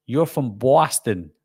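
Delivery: slow, drawn-out New York "Boston"; deliberate mock New York accent. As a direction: imitate a New Yorker, stretching the "aw" in "Boston". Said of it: "someone from New York would say".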